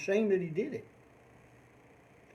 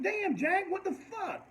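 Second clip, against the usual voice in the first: startled cry at the dog, trailing off; shocked exclamation; 12 semitones higher, slower than usual. Retold or imitated. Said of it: "I was like".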